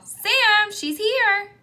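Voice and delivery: high excited call up the stairs. A narrator's reading. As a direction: shout it up to your brother, bright and high. Child calls up to brother.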